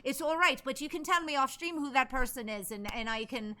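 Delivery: put-on improvised Irish accent, chatty. Streamer filling three requested minutes of accents, Irish accent. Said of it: "request his three minutes of accents".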